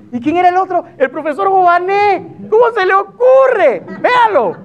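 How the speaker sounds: exasperated commentator cueing the clip; can't believe he did that; mock outrage. Comedian's mock outrage building to clip.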